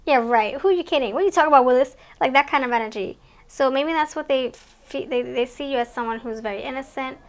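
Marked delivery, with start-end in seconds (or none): 0.1-2.0 mock disbelief. Voicing friends' scoffing disbelief.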